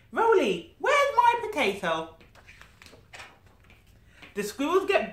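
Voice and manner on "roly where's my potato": high, loud sing-song call; playful call. Acted. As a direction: call out for the lost potato. Voicing a family member's call.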